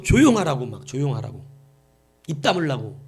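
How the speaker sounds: ordinary talk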